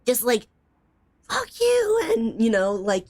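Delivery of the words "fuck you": high mock scream at a partner; a hammed-up high quarrel line; imitated yelling. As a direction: play a screaming neighbor, pitched way up.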